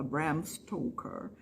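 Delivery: softly whispered, the O in Stoker drawn out; whispered Bram Stoker. Shown as a demonstration. Teacher whispering the name to students, softly. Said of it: "É um som de O".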